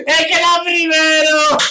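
high, loud laughing victory shout; ecstatic shout. Real, not acted. Gamer shouts to viewers that he came first, ecstatic. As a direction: scream with joy at winning.

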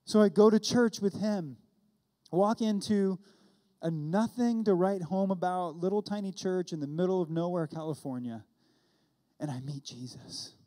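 ordinary talk, nothing marked

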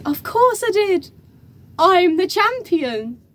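reply high and proud, stretching the title; proud boast. Retold or imitated. Young winner answering the host, proud boast.

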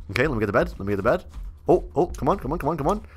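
usual speaking voice

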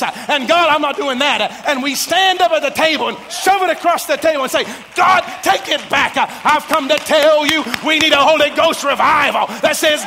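loud, rising sermon cadence building to a shout; full-force preaching. Acted. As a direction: preach it up to the rafters.

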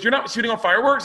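very high, fast startled woman; surprised neighbor. Retold or imitated. Neighbor realizing her error, high fast surprise. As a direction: realize the mistake in shock. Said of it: "And she goes".

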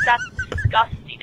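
high laughing cry of disgust. Retold or imitated. Caller retelling a vomiting guy, laughing. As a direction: squeal it out through a laugh.

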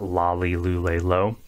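lolly, lule, lo with a full English L; clear L sound. Shown as a demonstration. Teacher showing learners what to avoid, plain L. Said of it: "it's not a L sound".

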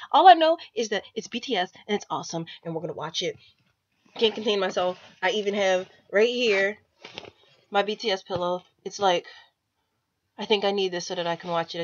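usual speaking voice

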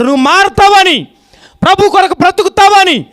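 high, loud altar call; fervent preacher shouting; preaching shout. Acted. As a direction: call out God's love to the congregation. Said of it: "గట్టిగా చెప్పాలా?".

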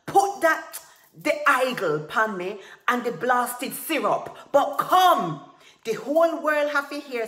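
high, very loud tirade; blind rage. A narrator's reading. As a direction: bellow orders, beside yourself with fury. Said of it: "blind with rage".

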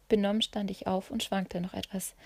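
plain reading voice

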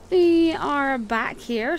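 slow, high, loud "We are back here" stretched out; drawn-out greeting. Acted. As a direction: sing out the welcome slowly and loudly.

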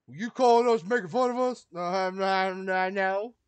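loud, high, hammed-up back-and-forth yell; mock accusing shout. Joking with the FBI game, a hammed-up loud shouted exchange. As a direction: shout the accusation then loudly deny it, playing both sides.

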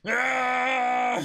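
loud slipping yelp; startled yell. Acted. Pirate slipping on the oily hill, startled yell. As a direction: yelp as he slips and falls.

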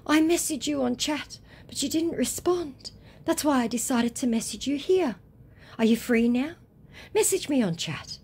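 high-pitched mock complaint; whiny scammer. Scammer nagging the woman to chat, high and mocking. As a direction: plead your excuse in a high put-on voice.

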